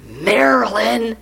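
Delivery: mock dismay; dismayed surprise. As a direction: stretch out the shock at moving east. Wife reacting to Maryland move.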